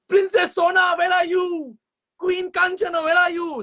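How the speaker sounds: king calling his family. A narrator's reading. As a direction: call for daughter and wife.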